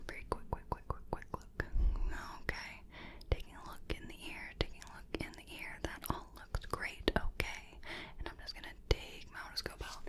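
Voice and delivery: doctor whispers during the ear check, very softly; faint close whisper. Acted. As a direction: breathe the words into the ear.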